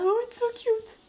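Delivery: very high-pitched mock cooing; attendrie et moqueuse. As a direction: squeal it like someone finding it adorable. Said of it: "Et après, elle est là". (imitation attendrie, voix suraiguë)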